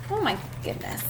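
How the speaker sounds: ordinary delivery, nothing marked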